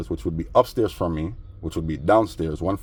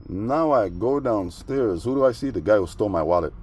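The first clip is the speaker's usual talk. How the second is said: ordinary talk, nothing marked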